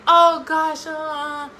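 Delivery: high, loud flustered "oh gosh"; mock embarrassed deflection of a compliment; bashful brush-off. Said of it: "don't do that".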